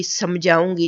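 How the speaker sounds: usual speaking voice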